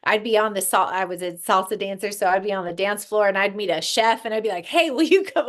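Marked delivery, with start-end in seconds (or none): 4.7-5.5 eager ask. Retold or imitated. Recruiting a chef mid-dance.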